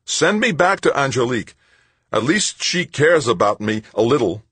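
insist, still worked up; upset demand. Acted. Tavalera demanding to be sent back to Angelique, upset.

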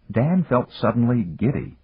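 ordinary delivery, nothing marked

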